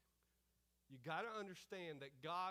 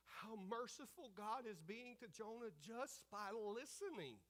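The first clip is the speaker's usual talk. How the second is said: ordinary talk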